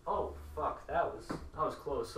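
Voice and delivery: ordinary delivery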